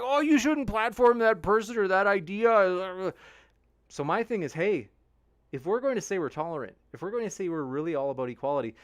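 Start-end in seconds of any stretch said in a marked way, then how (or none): 0.0-3.1 high, slow, prissy mock scold; slow high sneer at a censorious critic; mocking whine. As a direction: parody a scolding critic, pitched up and drawn out. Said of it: "they're like".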